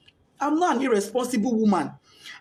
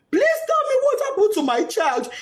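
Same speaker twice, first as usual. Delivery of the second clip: pleading cry; 11 semitones higher than usual. Retold or imitated. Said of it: "I knelt down".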